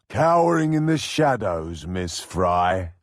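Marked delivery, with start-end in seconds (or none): none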